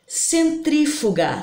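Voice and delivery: slow, split Centrífuga into separate syllables; teacher breaking Centrífuga into syllables. Shown as a demonstration. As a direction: spell out Centrífuga slowly, one syllable at a time. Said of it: "separando a palavra centrífuga".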